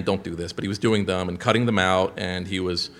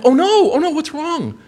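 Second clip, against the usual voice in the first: high-pitched mock dismay; imitating a distressed, concerned friend; retold alarm; 5 dB louder than usual. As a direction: act out worried alarm, pitched way up.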